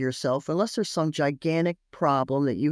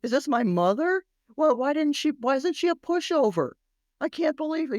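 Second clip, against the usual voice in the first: put-on high, astonished little-boy voice; a stunned four-year-old thinking aloud; child's bewildered inner voice; 9 semitones higher than usual. Stunned child wondering about his strict mother, high bewildered voice. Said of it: "He was like".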